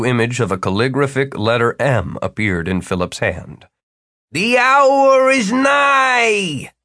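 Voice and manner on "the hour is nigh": very slow, high-pitched booming proclamation; drawn-out wizardly bellow; grand bellow. A narrator's reading. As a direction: bellow it like a ceremonial summons, stretching every word. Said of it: "Jimmy's voice bellowed".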